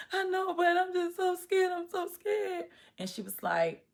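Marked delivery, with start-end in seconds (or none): 0.1-2.7 very high, whiny scared plea; retold tearful fear. Crying to the sheriff who scolded her, scared. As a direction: imitate pleading through tears, pitched way up. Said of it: "Stop crying".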